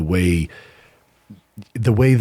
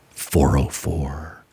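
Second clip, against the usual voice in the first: low, slow and flat delivery of the error code; slow deadpan; 6 semitones lower, 3 dB quieter, much slower than usual. Retold or imitated. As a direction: drop the voice and drag out "four oh four". 404 error, told with deadpan slowness.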